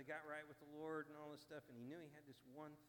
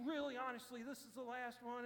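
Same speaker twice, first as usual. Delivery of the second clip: louder, higher, pleading to be believed; insistent promise; 9 semitones higher, 8 dB louder than usual. Retold or imitated. Alcoholic swearing to visitors it's the last, louder and higher.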